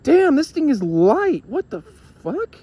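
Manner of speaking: high, slow, drawn-out disbelief; amazed. Retold or imitated. Friend weighing the device in his hand, high stunned disbelief. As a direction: marvel at how light it is.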